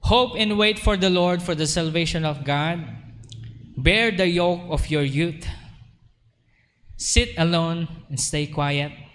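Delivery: measured, solemn Bible reading; scripture reading. Acted. Pastor reading scripture to the congregation, solemn and measured.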